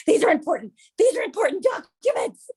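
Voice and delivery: put-on high, urgent little boy insisting; kid's panicked voice. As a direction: play the frantic son guarding his papers. Son voiced chasing papers.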